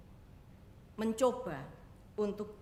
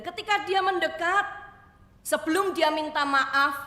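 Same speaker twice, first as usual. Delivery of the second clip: rising preaching emphasis; 7 semitones higher, 11 dB louder than usual. A narrator's reading. Preacher builds climax.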